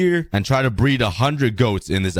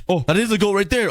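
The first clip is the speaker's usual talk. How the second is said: quick, excited, goat spotted; excited discovery; 9 semitones higher, faster than usual. Real, not acted. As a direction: blurt out the find with delight.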